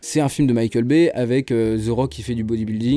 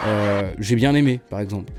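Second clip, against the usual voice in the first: normal talking voice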